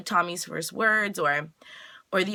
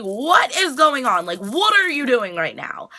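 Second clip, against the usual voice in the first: higher, louder mock disbelief; incredulous outburst; 5 semitones higher, 5 dB louder than usual. She sees him taking off his shirt, incredulous. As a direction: demand an explanation, drawing out the question. Retelling an acted confrontation.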